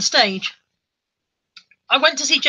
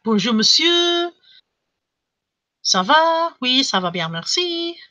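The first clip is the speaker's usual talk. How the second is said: drawn-out, rising French endings; mock French; 4 semitones higher, slower than usual. Shown as a demonstration. Showing her limited French, hammed-up French accent. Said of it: "to sound properly French".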